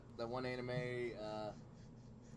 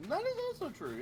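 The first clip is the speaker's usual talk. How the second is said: much higher, louder sing-song; high-pitched playful agreement; 6 dB louder than usual. Real, not acted. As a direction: jump way up in pitch for fun.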